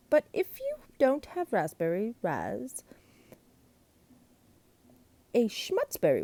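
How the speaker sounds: high cheerful monster prattle; chatty glunk. A narrator's reading. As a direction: babble on sweetly to mother. Glunk back on phone.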